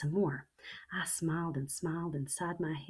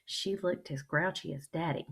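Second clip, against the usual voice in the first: plain reading voice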